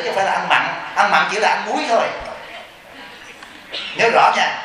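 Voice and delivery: ordinary talk, nothing marked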